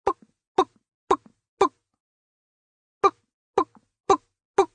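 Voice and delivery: very high, squeaky imitation of clogs; shrill clog clacks. Imitating her clogs on the hotel marble floor, shrill and annoying. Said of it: "was that shrill and annoying".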